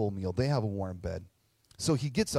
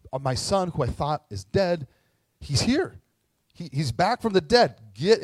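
rising to a loud, high shout of joy; joyful calling out; 5 semitones higher, 5 dB louder than usual. Retold or imitated. Father shouting to his people that his son is back, joyful shout. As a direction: call it out across the field to everyone.

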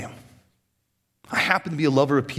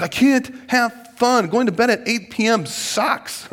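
sulky complaint; 8 semitones higher, slower than usual. Retold or imitated. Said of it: "I'm like".

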